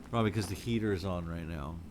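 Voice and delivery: usual speaking voice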